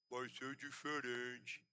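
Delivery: low, slow, soft mumble imitating a stroke patient; barely manage to form the words; slurred stroke speech. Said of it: "Fala direito".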